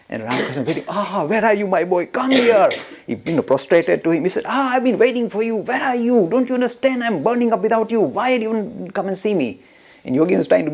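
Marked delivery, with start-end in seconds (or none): 0.9-2.7 high, excited acted welcome, louder at the end; impatient, delighted master's cry; eager calling. Master eagerly greets returning disciple. 4.5-9.6 high-pitched, acted outpouring of longing; urgent, reproachful welcome, pitched high throughout; impassioned pleading. Master's impassioned plea to disciple.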